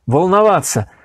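ordinary delivery, nothing marked

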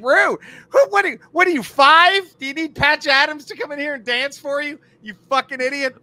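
loud, high-pitched comic outrage; incredulous rant. Acted. Comedian berating a childish Yelp reviewer, incredulous rant. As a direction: tear into the complainer, pitch way up.

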